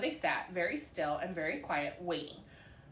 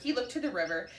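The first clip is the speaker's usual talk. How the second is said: ordinary delivery, nothing marked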